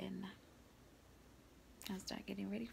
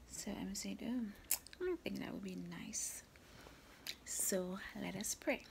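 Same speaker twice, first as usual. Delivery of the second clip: whispering. Real, not acted. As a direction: ease into prayer in a whisper. Whispers all through the vlog.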